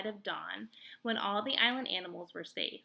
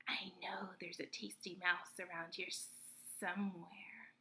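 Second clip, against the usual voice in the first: sly snake whisper, breathy and hushed; hissing whisper; 11 dB quieter than usual. A narrator's reading. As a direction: hiss it to yourself like a hungry snake. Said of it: "Snakes often hissed to themselves".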